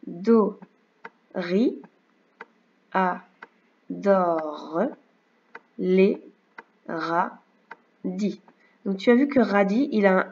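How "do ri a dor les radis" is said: syllable by syllable, a pause after each; split into syllables. Shown as a demonstration. Teacher reads the sentence to a child in syllables, one beat at a time. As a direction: spell out "Do, ri, a, dor, les, radis" one syllable at a time, slowly. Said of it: "on va faire les syllabes si tu veux".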